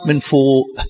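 slow, measured verse reading; solemn scripture recitation. Acted. Preacher reading a verse to his congregation, slow and solemn. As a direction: intone the verse slowly and gravely.

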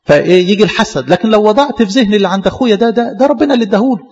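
ordinary talk

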